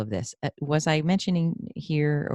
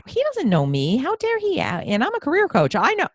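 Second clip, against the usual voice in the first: much higher, louder indignant venting; retold angry rant; 14 semitones higher, 4 dB louder than usual. The host replaying her fuming drive home, indignant. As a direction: huff it out like you're still fuming. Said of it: "I'm driving home going".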